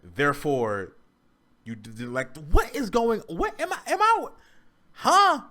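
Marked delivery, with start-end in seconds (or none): 2.5-5.4 high, loud, incredulous sputtering; baffled outburst. Real, not acted. Reactor lost by the video's logic, baffled outburst. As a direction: stammer in loud, high-pitched disbelief.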